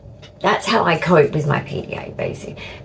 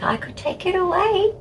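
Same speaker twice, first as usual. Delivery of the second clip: soft, much higher imitation of a worried partner; gentle pleading; 12 semitones higher, 6 dB quieter than usual. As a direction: coax softly in a high little voice. Voices partner offering pain relief.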